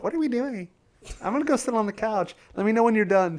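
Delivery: high-pitched mock clueless parent; uninterested parent. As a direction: imitate a parent who doesn't get it.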